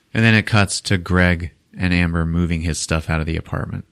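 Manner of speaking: ordinary talk, nothing marked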